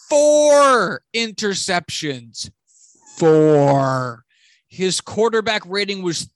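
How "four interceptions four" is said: slow, loud, drawn-out disbelief; exasperated stress. Real, not acted. Fan ranting to listeners about four picks, loud and slow. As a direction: hammer the count home in disgust.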